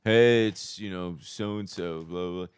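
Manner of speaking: slow, quiet, lazy DJ intro; imitating the sandwich-eating college DJ; mock DJ. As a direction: drone it out half-hearted. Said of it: "this one fucking clown".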